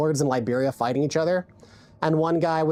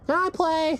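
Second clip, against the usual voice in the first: put-on high-pitched pestering little kid voice; squeaky mock whine, drawn out at the end; begging kid; 12 semitones higher, slower than usual. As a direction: mimic a kid pleading to join in. Mimics young players asking.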